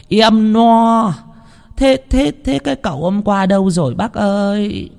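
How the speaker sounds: high, tearful wailing woman; whimpering lament. A narrator's reading. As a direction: wail to the chief, near tears. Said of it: "Bà Mai mếu máo". (bà Mai mếu máo, giọng cao, gần khóc)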